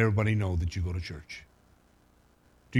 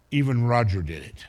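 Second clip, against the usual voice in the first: ordinary talk, nothing marked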